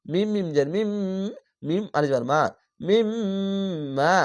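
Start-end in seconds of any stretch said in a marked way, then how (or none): 2.9-4.1 slow nasalized recitation; ghunna. Shown as a demonstration. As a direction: hold the গুন্না in মিম্মা. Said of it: "এখানে ওয়াজিব গুন্না".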